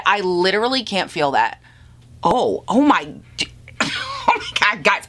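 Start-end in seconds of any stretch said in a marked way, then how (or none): 2.2-5.0 shocked and delighted; amazed. Real, not acted. Amazed by lightweight foundation.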